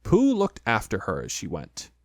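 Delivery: ordinary delivery, nothing marked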